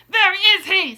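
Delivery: high, loud, sharp demand; imperious witch's bark; snapped question. A narrator's reading. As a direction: snap it out, furious at losing him. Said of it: "snapped the Grand High Witch".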